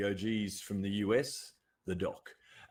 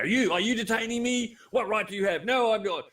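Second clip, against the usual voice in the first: loud, high, indignant challenge to police; mock confrontational cop-watcher; 9 semitones higher, 5 dB louder than usual. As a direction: play the smug YouTube agitator demanding his rights. Mocking aggressive cop-watcher exchange.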